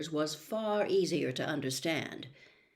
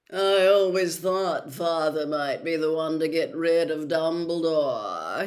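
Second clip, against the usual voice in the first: gloating Malfoy; 7 dB louder than usual. Acted. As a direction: gloat openly so they hear. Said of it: "not troubling to keep his voice down".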